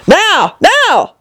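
urgent cry rising in pitch. A narrator's reading. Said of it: "cried the Queen".

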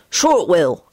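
ordinary delivery

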